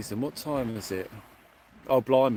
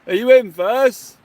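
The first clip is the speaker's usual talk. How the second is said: calling out; 8 semitones higher, 13 dB louder than usual. Real, not acted. Calling to a distant angler.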